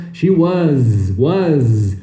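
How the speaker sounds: SHE WAS with long buzzing Z; forced pronunciation. Shown as a demonstration. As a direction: overdo "wuhzzz" as a drill. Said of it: "pode forçar".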